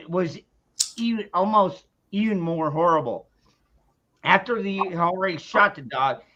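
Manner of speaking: normal talking voice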